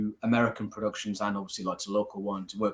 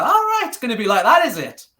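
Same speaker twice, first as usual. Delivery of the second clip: defiant challenge; 12 semitones higher, 9 dB louder than usual. Retold or imitated. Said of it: "I was like".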